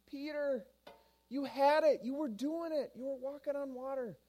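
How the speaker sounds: high-pitched, incredulous mild reproach; exasperated encouragement. Acted. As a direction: chide a friend who nearly made it. Jesus chides Peter after rescue.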